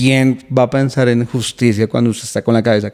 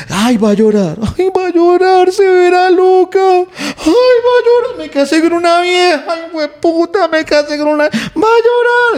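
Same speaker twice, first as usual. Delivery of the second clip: mocking girlfriend; 5 dB louder than usual. Girlfriend mocking crying boyfriend, very high mock-crying sneer.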